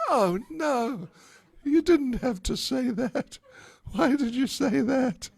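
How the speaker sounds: high, fretful inner wail; dismayed young girl. Acted. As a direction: pitch it up in worried dismay. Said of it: "Radharani thought".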